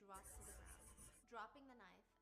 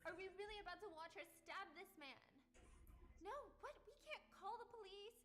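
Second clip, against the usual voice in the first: loud, high panicked yelling; panicked shouting; 10 semitones higher, 5 dB louder than usual. Acted. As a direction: yell it in panic. Voicing a panicked argument.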